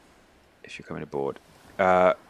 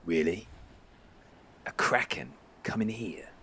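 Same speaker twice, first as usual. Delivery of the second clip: sceptical hushed question; 10 dB quieter than usual. Acted. Bjorn doubting the kraken tale, hushed and sceptical. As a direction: ask it softly, half whispered.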